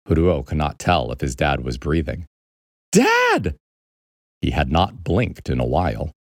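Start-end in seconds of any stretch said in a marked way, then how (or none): 2.9-3.6 put-on very high-pitched boy's cry; urgent calling. Boy trying to rouse his frozen dad, urgent. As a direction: call out sharply in a high kid voice.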